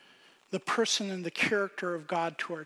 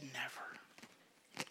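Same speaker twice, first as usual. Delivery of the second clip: breathy whisper, much softer; whispered never; 6 semitones lower, 19 dB quieter than usual. Retold or imitated. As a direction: confide it under your breath. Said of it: "She said".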